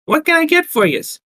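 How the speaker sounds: high-pitched Brooklyn barmaid; heavy Brooklyn accent. Acted. Barmaid taking the newcomers' order, heavy Brooklyn accent. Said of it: "asked in a heavy Brooklyn accent".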